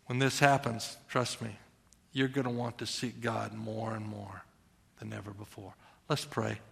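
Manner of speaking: soft, trailing close; hushed. Real, not acted. Preacher softly ends the sermon before prayer. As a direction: drop to a near murmur.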